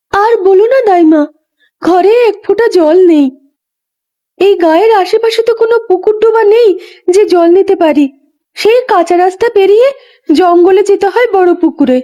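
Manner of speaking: put-on high, light young woman's voice; a pregnant young wife, lively and a bit weary; young village wife. Young Ganga tells the old midwife about the far pond, in a high young voice.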